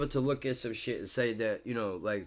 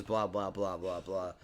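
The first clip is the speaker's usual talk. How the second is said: soft, low throwaway blah; dismissive; 8 semitones lower, 9 dB quieter than usual. Real, not acted. Vlogger brushes off the rest of the list, dismissive. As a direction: wave it off under your breath.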